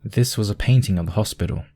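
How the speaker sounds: usual speaking voice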